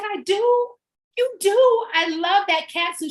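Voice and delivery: high, loud gushing praise; gushing compliment. Retold or imitated. Passenger gushing back at the driver, high and loud. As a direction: squeal the compliment back at her. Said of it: "She said".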